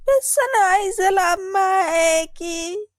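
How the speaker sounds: put-on high, loud, slow sobbing little girl; little sister crying as she pleads to play; crying child plea. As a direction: beg through tears to be let in.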